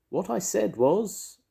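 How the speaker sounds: high, loud, insistent repeat; calling out very loudly. A narrator's reading. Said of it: "called out Pooh very loudly".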